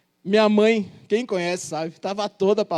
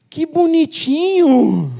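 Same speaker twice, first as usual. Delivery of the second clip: loud, high, drawn-out cooing; cooing clerk; 9 semitones higher, 9 dB louder, much slower than usual. Retold or imitated. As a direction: gush over something adorable. Court clerk reacts sweetly.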